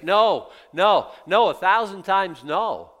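emphatic no. Real, not acted. Preacher answering his congregation, loud and emphatic. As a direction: hammer each no home.

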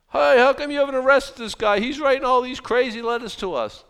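loud, high, outraged demand; indignant shout. Retold or imitated. Official shouting at the king about the prophet, loud and indignant. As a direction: yell it like an official fed up with the prophet.

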